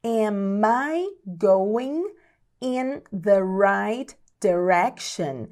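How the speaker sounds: very slow, phrase broken into chunks; slow teaching pace. Shown as a demonstration. English teacher models checking the route, very slowly. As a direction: draw out each word with pauses.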